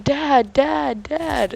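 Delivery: high, loud repeated calls of Dad; calling out. Retold or imitated. Kid calling the narrator his dad, loud high calls. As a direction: call out like a kid wanting attention.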